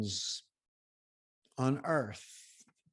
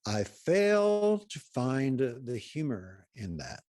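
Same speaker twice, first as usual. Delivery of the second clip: slow, higher, stretched-out stern reply; measured disapproval; 4 semitones higher, 4 dB louder, much slower than usual. Retold or imitated. Imitating a very serious caller answering a joke, slow measured disapproval. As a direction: draw out "fail" with measured, humorless gravity. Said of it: "take in a measured breath and say".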